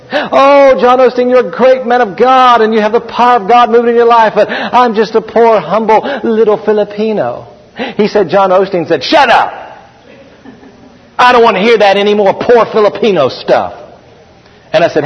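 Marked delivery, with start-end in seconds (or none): 0.1-7.5 high, gushing hammed-up praise; humble Filipino translator. Translator saying it one too many times to John Osteen, humble imitation. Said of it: "when he said that to John Osteen". 9.0-13.7 mock sharp rebuke, pitched higher; retold scolding. John Osteen fed up with the translator's self-pity, retold scolding. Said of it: "John Osteen kind of scolded him".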